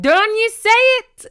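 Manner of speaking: high, drawn-out Scottish cry; scolding shout. A narrator's reading. Gordon warning his clansman off, scolding shout. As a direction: shout it slowly in hammy Scots. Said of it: "said Gordon, shaking his balding head".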